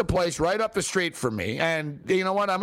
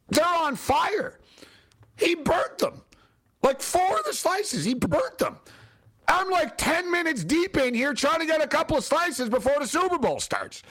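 exasperated outrage; 8 semitones higher than usual. Real, not acted. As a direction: complain in high-pitched outrage. Outraged at the burnt slices.

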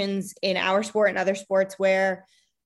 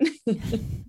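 soft laugh; 3 semitones higher, 4 dB quieter than usual. Real, not acted. Laugh after story.

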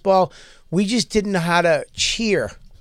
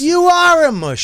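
high, loud, drawn-out retort; yelled insult; 12 semitones higher, 7 dB louder than usual. Real, not acted. Friend insists on the insult in an argument, loud high yell. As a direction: holler it slowly right back at him. Yelling back in a mock quarrel.

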